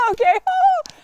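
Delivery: loud, very high mock laughing fit; shrieking imitation of crazed laughter; hysterical laughing. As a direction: burst into hammed-up maniacal laughter. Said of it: "have to laugh hysterically".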